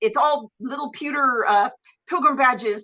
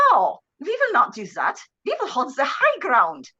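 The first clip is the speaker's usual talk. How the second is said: rough attempt at a Swedish prince's accent; mangled Swedish accent. Retelling the prince's order to hold ground, mangled Swedish accent. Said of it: "I can't do the accent".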